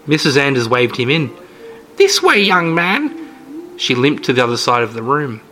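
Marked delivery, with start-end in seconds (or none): 2.0-3.1 put-on high, slow old woman voice; sweet, creepy old lady.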